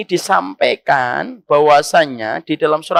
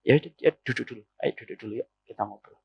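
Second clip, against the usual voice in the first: low, soft husband voice; hushed, low, placating husband; husband's calm reply; 10 semitones lower, 10 dB quieter, faster than usual. Acted. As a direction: quietly invite her to sit and talk. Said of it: "Biasanya apa, suami?".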